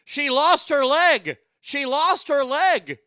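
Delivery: loud, slow, high retold yell of panic; slow, loud cry of alarm; horrified shout. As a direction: shout it like a horrified onlooker on the beach. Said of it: "Horrified onlookers could be heard shouting".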